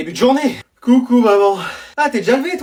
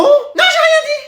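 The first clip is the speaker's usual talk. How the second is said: jumps way up high, feigning innocence; innocent backpedal. Acted. Student caught by the teacher, squeaking high and innocent. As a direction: squeak it like nothing was said.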